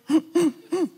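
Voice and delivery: sharp, high sob-gasp for breath; demo gasp. Shown as a demonstration. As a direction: show the gulp of air when weeping from the gut. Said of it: "looking for a breath".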